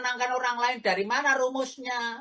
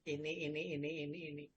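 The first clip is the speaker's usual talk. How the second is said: whispered hint; 7 semitones lower, 15 dB quieter, much faster than usual. Retold or imitated. Angel whispering the idea.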